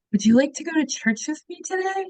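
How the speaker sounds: high, sweet, gentle invitation; sweet mom voice. Retold or imitated. Mother gently asking her daughter to church, high and sweet. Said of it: "she goes".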